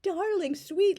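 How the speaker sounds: high, slow, syrupy mock sweetness; hammed-up doting child voice; gushing sappy daughter. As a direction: gush over mama like a Victorian parody. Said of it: "an over-the-top parody".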